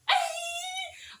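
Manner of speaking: very high delighted squeal; high-pitched squeal. Real, not acted. Cashier delighted at customers' praise, squealing.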